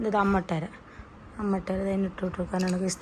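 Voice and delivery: ordinary talk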